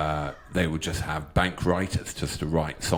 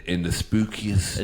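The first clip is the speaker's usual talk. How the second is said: spooky voice; slower than usual. Pretend. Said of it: "Now, spooky voice".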